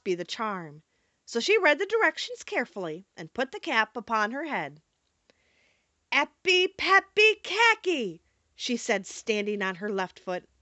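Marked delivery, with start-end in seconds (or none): 6.1-8.2 slow, high, emphatic chant; magic incantation. A narrator's reading. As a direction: intone nonsense spell words deliberately. Dorothy recites the magic charm.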